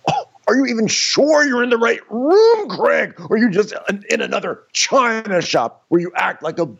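high-pitched, loud, hammed-up outrage; squealing hammed-up scolding; mock indignant rant. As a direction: shriek the accusation in mock fury. Mock angry rant at Craig.

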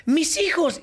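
high panicked shout of a mother; frantic mother's shout. Retold or imitated. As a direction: cry out for lost children. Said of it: "mi mamá que salió corriendo".

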